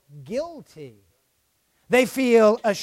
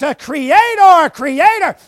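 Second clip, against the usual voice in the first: creation crying out; 8 semitones higher, 11 dB louder than usual. Retold or imitated. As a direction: cry it out to the whole world. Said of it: "It cries out".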